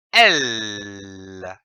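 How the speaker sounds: a long, steady L held on the tongue; "L." sung out as a pure held L, tongue up at the palate; held L sound. Shown as a demonstration. Teacher showing pupils the L sound, held long. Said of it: "en prononçant le son".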